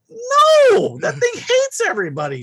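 very high, loud shout of protest. Retold or imitated. Son shouting at mom about the biting dog. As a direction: shout it at her in disbelief. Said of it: "I'm like".

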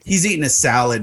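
ordinary talk, nothing marked

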